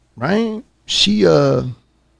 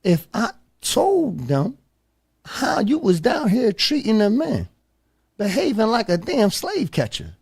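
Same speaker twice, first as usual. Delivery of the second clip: stern confrontation; 8 semitones higher, 5 dB quieter than usual. Retold or imitated. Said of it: "I said to her".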